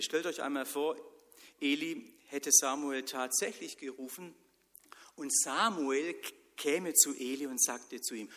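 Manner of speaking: ordinary talk, nothing marked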